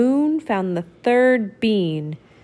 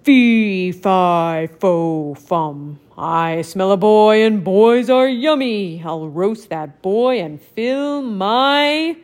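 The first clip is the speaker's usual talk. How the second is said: dim giant cyclops; 2 semitones lower, 3 dB louder, slower than usual. Acted. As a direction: rumble it out like a hungry giant. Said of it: "and said".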